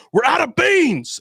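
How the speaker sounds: high, slow shouted mock yell; drive-thru worker yelling at a vegan customer, mock shout; mock angry yell. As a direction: bark it like a furious worker.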